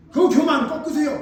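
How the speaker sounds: loud, high pastor's rebuke; retold shout. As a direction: shout the order as if scolding. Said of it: "목사님께 소리를 치는 거예요".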